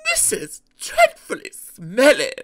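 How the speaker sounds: high, loud, disgusted diesel voice; fussy Daisy. A narrator's reading. Daisy shuddering at the engine shed, loudly disgusted. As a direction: announce your disgust in a high put-on voice. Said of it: "she announced".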